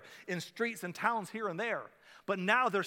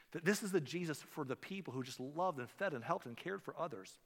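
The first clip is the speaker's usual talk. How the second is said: ordinary talk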